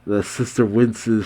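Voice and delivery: ordinary delivery, nothing marked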